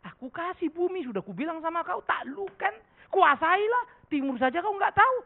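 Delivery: a hammed-up angry God scolding. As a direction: scold the ignorant servant as God would. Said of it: "Marah Tuhan nanti sama kita".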